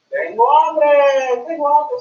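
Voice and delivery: slow loud "Tengo hambre" for repeating; slow teaching model. Shown as a demonstration. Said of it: "say that, please".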